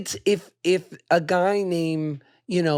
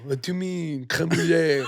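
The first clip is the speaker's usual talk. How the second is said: put-on French accent. Mock Frenchman after creme brulee.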